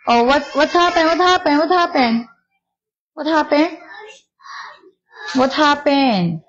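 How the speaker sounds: high, sing-song coo to a little one. Real, not acted. Mother cooing to her crying baby, high sing-song.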